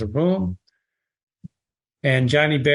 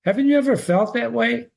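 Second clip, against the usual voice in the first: much higher pleading question; soft pleading voice; 9 semitones higher, faster than usual. A narrator's reading. Amy asking Emmeline, soft high pleading. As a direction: plead softly with her. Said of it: "Says the voice asking Emmeline".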